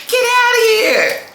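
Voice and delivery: very high, loud mock send-off; playful yell. Reader jokingly chasing someone out, high mock yell. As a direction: shoo them off in a high, playful holler.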